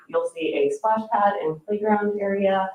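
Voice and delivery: ordinary delivery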